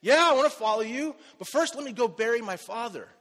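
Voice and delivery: bright, loud and high with eagerness; eager excitement. Retold or imitated. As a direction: jump at the chance to follow. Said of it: "And this man was excited".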